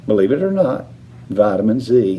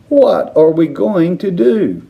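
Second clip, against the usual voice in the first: wailing; 7 semitones higher, 3 dB louder than usual. A narrator's reading. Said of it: "wailed Missus Teavee".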